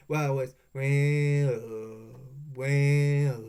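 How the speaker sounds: stuck for words, dragging out each well very slowly; halting drawn-out well. Shown as a demonstration. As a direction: stall helplessly on each well. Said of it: "like that".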